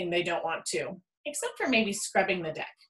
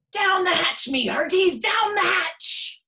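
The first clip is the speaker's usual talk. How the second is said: high, loud, slow toast; pirate toast yell; 11 semitones higher, 5 dB louder, slower than usual. A narrator's reading. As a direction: roar a rowdy pirate toast. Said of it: "they all yelled".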